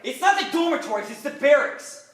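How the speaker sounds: high, loud, exasperated correction; irritated correction. Acted. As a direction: correct her hotly, slowing at the end. Acted quarrel, he corrects her.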